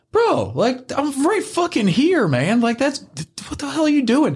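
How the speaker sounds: telling a stranger he's right there, high and indignant; indignant disbelief. Retold or imitated. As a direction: call him out like you can't believe it. Man fiddling in pocket nearby.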